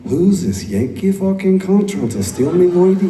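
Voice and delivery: comic outrage at the Yankee stealing his friend; mock jealous Scottish rant. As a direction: bellow it like a jealous drunk mate. Imitating Craig's jealous rant.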